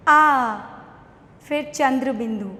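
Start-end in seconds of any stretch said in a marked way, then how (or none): 0.1-0.6 loud, drawn-out आ; spelling out a sound. Shown as a demonstration. Teacher sounding out आ for young pupils. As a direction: call out आ clearly for the kids. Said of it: "आ की मात्रा".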